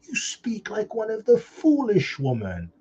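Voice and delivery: slow, scornful rebuke as Job; full-blown argument. Retold or imitated. Preacher voicing Job rebuking his wife, slow scornful argument. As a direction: put her down with slow contempt. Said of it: "it was a full-blown argument".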